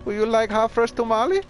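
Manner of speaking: imitating a street tamale seller with a Mexican accent; tamale lady voice. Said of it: "she's all like".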